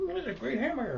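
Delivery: a high, sing-song mom lost in her dream; mock sleep-talking mom. Telling how mom sat up in bed, in a high mock voice.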